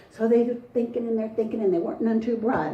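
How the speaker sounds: plain reading voice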